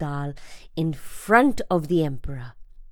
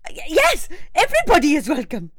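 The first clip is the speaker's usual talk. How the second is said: stammered, fast and higher-pitched; quick flustered reply; 10 semitones higher, 4 dB louder, faster than usual. A narrator's reading. Boy answers the emperor fast, flustered. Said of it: "quickly replied".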